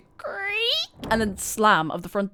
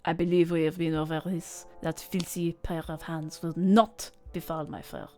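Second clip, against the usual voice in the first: haughty cat voice; 3 semitones lower, 5 dB quieter than usual. Acted. Cat character voiced in game.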